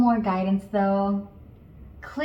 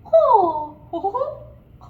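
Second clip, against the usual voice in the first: high, loud cartoon-mouse ko; Mickey Mouse ko; 12 semitones higher, 7 dB louder than usual. Shown as a demonstration. Coach modelling ko like Mickey Mouse, high cartoon voice. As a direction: think Mickey Mouse, squeak it out. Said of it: "so think Mickey Mouse".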